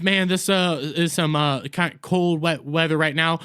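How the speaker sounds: ordinary talk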